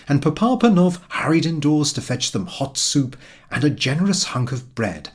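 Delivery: usual speaking voice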